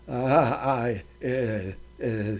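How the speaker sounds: panicked stammer, barely audible; stuttering. Acted.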